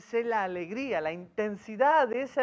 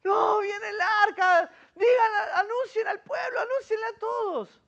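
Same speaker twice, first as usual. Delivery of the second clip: excited shouting; 10 semitones higher than usual. Retold or imitated. Embracing villagers shouting to announce the ark to the people. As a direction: cry out for everyone to go and tell. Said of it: "me imagino algo así".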